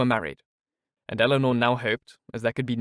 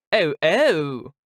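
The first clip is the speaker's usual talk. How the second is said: loud, high teasing cry; cried out; 5 semitones higher, 6 dB louder than usual. Acted. Mrs Jennings teases Miss Steele, loud cry. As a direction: crow with knowing delight. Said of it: "cried Missus Jennings".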